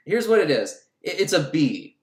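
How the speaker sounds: usual speaking voice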